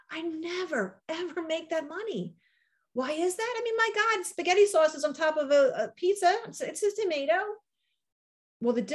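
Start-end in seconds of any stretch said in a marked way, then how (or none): 0.1-7.4 incredulous. Real, not acted. Outraged at pizza versus tomato.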